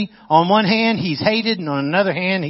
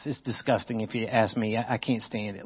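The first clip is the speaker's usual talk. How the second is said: muttered disgust; 5 semitones lower, 11 dB quieter than usual. Real, not acted. Preacher disgusted at emotionless Jesus.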